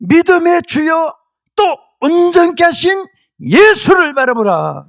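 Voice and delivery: high, loud, slow proclamation; full-force preacher's cry; shouted scripture declamation. Acted. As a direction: thunder the verse out, stretching words.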